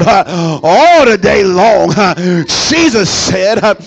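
loud, rhythmic whooping sermon; fiery preaching. Acted. Pastor preaching to the congregation, loud and rising. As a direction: preach it at full force to the church. Preacher building to full force.

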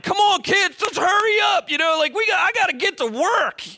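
put-on high, loud coaching shout at tired kids; yelling at the kids. Coach yelling at dragging kids at morning practice, loud and high. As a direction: hurry the sluggish kids along, hollering. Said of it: "and so I start yelling".

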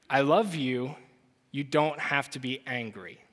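soft, low and gentle; father calming his screaming daughter, soft and tender. Retold or imitated. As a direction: hush it like soothing a child.

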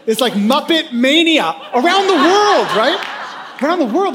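high, laughing exclamation. Real, not acted. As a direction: crack up over the photos. Laughing at his photos.

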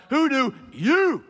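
YOU punched high, loud and long; slow question soaring on "you"; stress on YOU. Shown as a demonstration. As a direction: hit "you" hard and stretch it. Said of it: "you cannot overemphasize this word enough".

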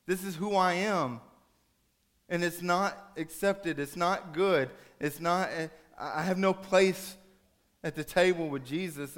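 worked-up, desperate outpouring; distraught son. Acted. Son's distraught outburst to his dad.

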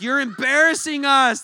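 put-on mother scolding her child in public; scolding mom. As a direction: snap it like an embarrassed mother. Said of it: "And the mom's like".

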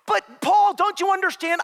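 very high, loud acted protest; shouted plea. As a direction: beg him at the top of your voice. Acted elders pleading with Paul.

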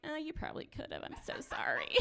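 whispered, half-laughing apology; a hushed, sheepish sorry. Retold or imitated. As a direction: breathe it like a guilty confession. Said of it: "I'm like".

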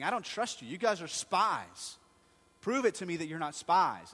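loud, harsh accusing ruler; harsh Joseph voice, spoken loud; stern accusation. Retold or imitated. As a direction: bark the charge at them. Joseph accusing brothers of spying.

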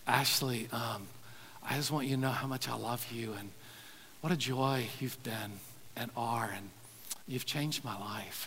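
soft and intimate, almost murmured; tender words to daughter. Real, not acted. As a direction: quietly tell your daughter how much you love her. Leaning over to his daughter.

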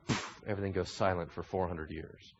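dropped low and soft; hushed. Acted. Preacher telling of the silence: hushed, low. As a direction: let the words fall silent. Dramatic silence after God speaks.

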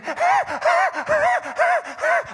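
high-pitched panicked gulps for air; drowning gasps. Acted. Preacher acts out Mario struggling in the waves, frantic high gasps. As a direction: gasp like a struggling swimmer. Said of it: "like this".

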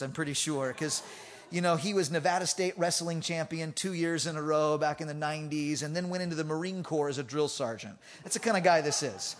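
ordinary delivery

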